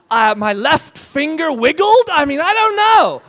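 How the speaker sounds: loud, rising mock bafflement; exasperated answer. Swimmer answering a silly interview question, loud exasperation.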